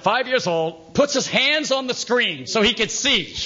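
usual speaking voice

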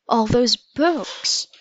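slow, mildly regretful lament; drawn-out, understated dismay; trailing off. A narrator's reading. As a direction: let it trail away slowly. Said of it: "His voice trailed off".

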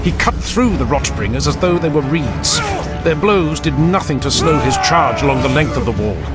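usual speaking voice

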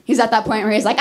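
usual speaking voice

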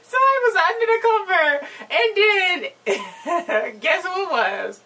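laughing. Real, not acted. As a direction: stretch the words out through real laughter. Retelling a scene she found hilarious.

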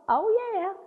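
high, airy "oh, yeah"; breezy dismissive oh yeah; breezy patient reply. Retold or imitated. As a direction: toss it off lightly.

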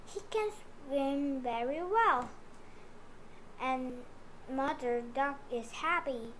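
ordinary delivery, nothing marked

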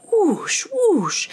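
high, loud whoosh; whooshing sound. A narrator's reading. Narrator voicing crawfish flying through the air, playful. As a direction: swoop the voice up and down.

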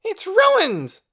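high, loud wailing scream; screamed outrage. A narrator's reading. Torin mourns his egg at Lena, screamed outrage. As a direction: scream it like a furious child. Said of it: "Torin screamed".

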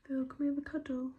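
high, cooing pet voice. Real, not acted. Cooing at the dog.

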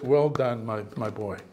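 low, soft fatherly praise; the Lord praising. Acted. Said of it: "the Lord says".

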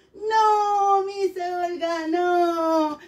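high, drawn-out chorus-like reply; children answering. Acted. Teacher voices pupils answering her question, sing-song. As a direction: stretch it out like a class replying together.

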